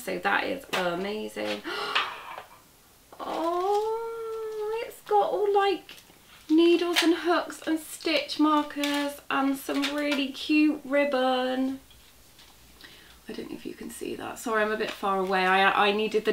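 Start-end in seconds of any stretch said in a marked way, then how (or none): 1.7-2.4 soft gasp. Real, not acted. Opening the box contents. 3.2-11.8 much higher, thrilled and gushing; delighted squeal. Real, not acted. Vlogger thrilled at finding needles and ribbon in the box. 13.3-14.4 fast, much softer whisper; whispered aside. Real, not acted. Vlogger whispering an aside to viewers while unboxing.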